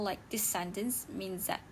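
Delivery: usual speaking voice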